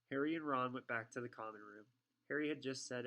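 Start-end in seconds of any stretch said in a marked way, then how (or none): none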